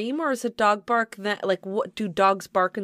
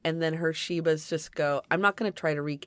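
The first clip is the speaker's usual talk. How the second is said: ordinary talk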